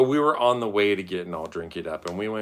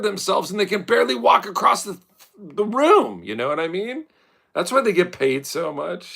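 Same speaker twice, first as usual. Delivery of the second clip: animated rant; 8 semitones higher, 6 dB louder than usual. Real, not acted. Ranting loudly about models.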